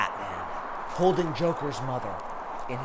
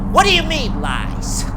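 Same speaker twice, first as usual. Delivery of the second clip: rising, louder indignant question; upset; 10 semitones higher, 9 dB louder than usual. A narrator's reading. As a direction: demand an answer, offended. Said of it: "Joker gets upset".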